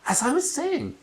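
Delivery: testy ghost mother; annoyed. Acted. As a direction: reclaim the conversation pointedly. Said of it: "sounding annoyed".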